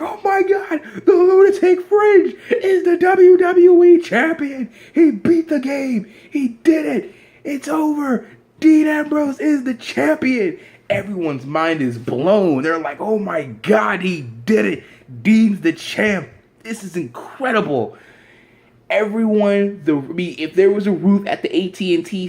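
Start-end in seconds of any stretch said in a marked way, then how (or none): 0.0-10.6 high, loud, drawn-out shriek of disbelief; slow, screaming championship call at full pitch; ecstatic announcer. Acted. Announcer calling a shock title win, ecstatic. 13.0-18.0 lightly acted awe, higher and drawn out; amazed crowd. Fans reacting to Dean's win, amazed.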